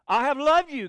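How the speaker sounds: loud and high I have loved you; a ringing divine declaration; God's proclamation. Acted. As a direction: proclaim it with full force. Preacher voicing God's declaration.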